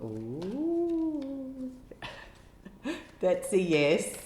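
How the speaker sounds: thrilled by the answer; excited ooh. Real, not acted. Reader reacts to a yes card, excited. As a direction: squeal up high, laugh, then a loud yes.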